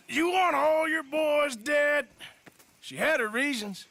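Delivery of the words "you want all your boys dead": slow, loud menacing threat; threatening shout. Acted. Arthur threatens the Foreman gang, slow loud shout. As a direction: lean in hard and make him back down.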